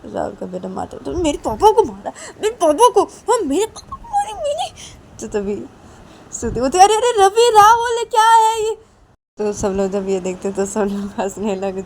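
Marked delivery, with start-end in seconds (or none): 1.0-4.7 put-on very high, loud sobbing little boy; a child whining through tears; crying child. Son cries that she hit his papa. 6.8-8.8 loud protest. Acted. Shruti shouts at Ravi and Rahul, high and loud.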